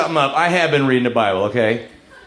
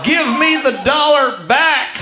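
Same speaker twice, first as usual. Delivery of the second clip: mock outraged yell; 11 semitones higher, slower than usual. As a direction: yell it slowly, word by word. Demanding money back from stripper.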